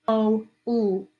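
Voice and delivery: "O, oo" as drawn-out separate vowel sounds; "O, oo", long O isolated, then the ending sound. Shown as a demonstration. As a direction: stretch "O, oo" piece by piece for a learner. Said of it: "That O sound".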